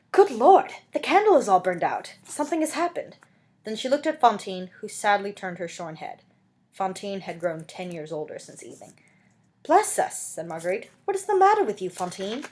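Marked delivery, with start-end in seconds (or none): 0.1-3.1 high, loud startled cry; alarmed exclamation. Acted. Marguerite finds the burned-out candle, high and alarmed. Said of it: "and exclaimed". 9.6-10.2 high, sharp alarm; startled exclamation. Acted. Marguerite sees Fantine aged overnight, high and startled. 11.0-12.4 high, worried asking; alarmed question. Acted. Marguerite asks Fantine what is wrong, high and worried.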